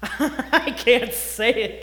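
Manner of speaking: very slow, giggling through each word. A narrator's reading. Rhinoceros unable to say his name, slow giggling. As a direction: barely get it out for giggling. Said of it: "giggled the rhinoceros".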